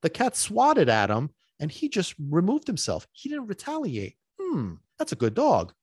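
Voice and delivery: high, impressed bystander voice coming round to the idea; onlooker won over. Retold or imitated. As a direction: play the convinced onlooker. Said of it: "people that are paying attention".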